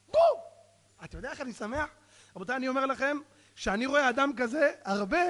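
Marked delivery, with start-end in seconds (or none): 0.1-0.4 high, loud boom; explosive hit. Acted. Preacher telling heckler his arrow hit, explosive boom. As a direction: burst it out like a strike landing.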